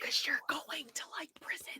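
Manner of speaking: mock whisper; voiceless hushed aside.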